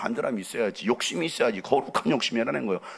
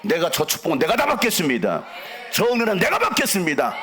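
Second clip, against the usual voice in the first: fervent pleading prayer. Acted. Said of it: "눈을 쌍불을 켜가면서". (간절하게 점점 높여 부르짖는 기도)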